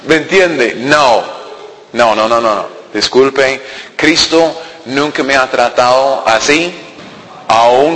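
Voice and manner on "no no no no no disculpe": usual speaking voice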